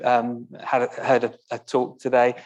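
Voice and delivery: usual speaking voice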